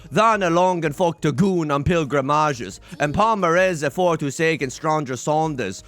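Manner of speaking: hammed-up old Middle English accent, slowed and rolling; theatrical Middle English accent, drawn out; Middle English reading. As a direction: recite Chaucer with a mock medieval accent. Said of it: "Is this problematic?".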